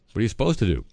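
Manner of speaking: normal talking voice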